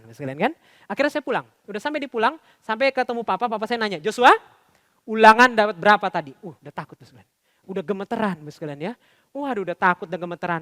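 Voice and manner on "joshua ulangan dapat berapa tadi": stern father. Retold or imitated. Father questions son about test.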